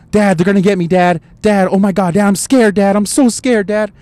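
put-on high, loud, panicky kid pleading; imitated scared son. Imitating his son pleading to Dad at the dentist, scared. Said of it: "screaming, all tripped out".

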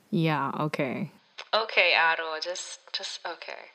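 low, fading mock brush-off; unconvinced dismissal. Friend humouring the relationship guru, unconvinced and dismissive. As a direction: voice a friend giving up, trailing into a mutter.

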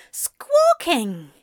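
high, offended squawk; a high indignant echo of squawking. A narrator's reading. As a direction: squawk the word back in outrage. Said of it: "Aziraphale squawked".

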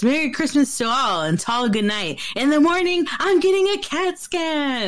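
imitated Santa farewell climbing to a high cry; mock exclamation. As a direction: start grandly, then pitch the punchline high.

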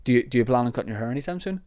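fast, light, throwaway question; quick and casual. Shown as a demonstration. Asking about hair casually, fast and light. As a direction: toss it off quickly and friendly. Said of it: "That's fine".

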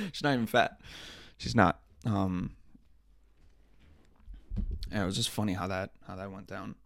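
ordinary delivery

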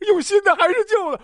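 high, anxious put-on questioning; indecisive patient. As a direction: press the doctor with a high, needy question. Indecisive patient voiced against doctor.

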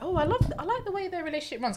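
high, quick, delighted inner voice; gushing quote. Retold or imitated. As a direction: gush over it breathlessly. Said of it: "I was like".

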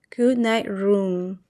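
ordinary delivery, nothing marked

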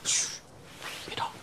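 hush, so they won't hear us; urgent whispered shush. Acted. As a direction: whisper it, telling someone to keep still. Hushing to hide from bullies.

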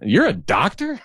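can't believe he's a doctor, drawn out; mild mock shock; disbelief. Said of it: "I'm like".

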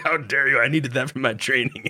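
a jokingly outraged Zora scolding the hero; mock outrage. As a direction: play the offended trainee, hammed up. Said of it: "He's like".

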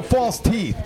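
ordinary talk, nothing marked

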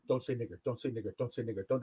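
low, soft, repeated warning to self; a man silently warning himself not to say it; inner voice. Retold or imitated. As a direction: murmur it like a thought looping in his head. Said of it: "That's what he's thinking in his head".

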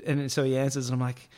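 ordinary talk, nothing marked

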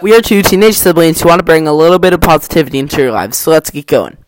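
loud, close to the mic, every syllable crisp; over-enunciated intro. Real, not acted. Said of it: "went very close to the mic and, like, tried to enunciate every single syllable".

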